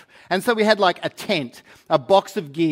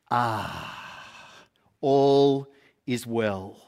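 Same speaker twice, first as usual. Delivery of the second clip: slow, lower sigh of relief with a drink; contented sigh; 6 semitones lower, 4 dB quieter, much slower than usual. Retold or imitated. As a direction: breathe out like the day is done. Relaxing with a drink after work.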